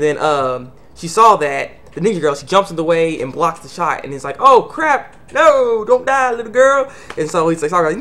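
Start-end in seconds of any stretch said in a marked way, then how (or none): 4.4-6.9 high, loud mock panic; hammed-up horrified yell; acted alarm. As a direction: cry out in dismay, stretching the words. Acted alarm as girl is shot.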